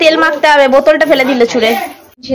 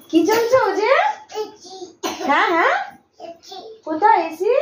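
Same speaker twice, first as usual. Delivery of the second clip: আদুরে প্রশ্ন; 7 semitones higher, 7 dB quieter than usual. Real, not acted. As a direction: coax a little one to answer, lifting the ends. Coaxing small child to talk. (আদুরে গলায় বাচ্চাকে জিজ্ঞেস)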